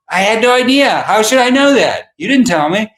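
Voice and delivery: mock defensive friend; imitating Lance. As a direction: protest innocently, pitched high. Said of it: "Lance protests on the call".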